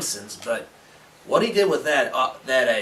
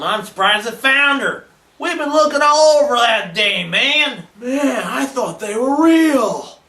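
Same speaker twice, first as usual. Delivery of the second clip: high, loud, disbelieving cry; dismayed astonishment; 8 semitones higher, 7 dB louder than usual. Acted. Fan learning the museum figures were actors, dismayed high wail. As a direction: wail it out, drawing out the last words.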